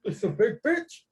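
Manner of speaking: high, playful echo; mock exclamation. Joking echoes of a catchphrase.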